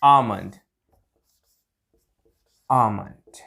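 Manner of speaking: आमंड with silent L, low falling tune; correct almond pronunciation. Shown as a demonstration. Teacher answering own question for learners, silent L. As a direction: drop the pitch and say आमंड without L. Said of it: "correct pronunciation क्या होगी".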